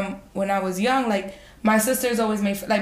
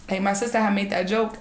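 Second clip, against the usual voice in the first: usual speaking voice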